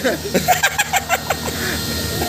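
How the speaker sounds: high burst of laughter. Real, not acted. Laughs at guest's reply.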